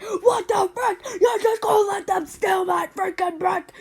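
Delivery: high, loud, disbelieving yell; shouting back at mom, outraged. Retold or imitated. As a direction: protest like it's the worst injustice. Protesting mom's order.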